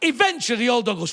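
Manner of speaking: ordinary delivery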